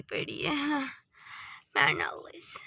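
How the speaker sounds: hushed catty aside; whispered insult. Acted. Wife mutters about her sister-in-law in a whisper. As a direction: whisper it behind her back.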